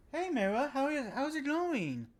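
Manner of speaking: high, sing-song hello; high-pitched happy greeting; excited greeting. Real, not acted. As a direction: greet a friend with a high, swooping lilt. Greeting someone joining the stream.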